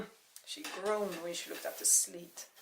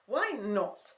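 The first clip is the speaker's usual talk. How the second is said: upset snap; 3 semitones higher, 9 dB louder than usual. Acted. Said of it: "so upset she refused".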